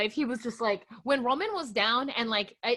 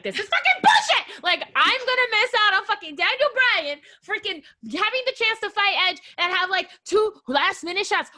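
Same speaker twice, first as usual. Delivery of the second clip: high, loud outraged tirade; shrieked opening, then sustained high-pitched ranting; retold screaming rant; 8 semitones higher, 4 dB louder than usual. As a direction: go off the rails re-enacting your furious rant. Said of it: "I was fucking screaming, and I was like".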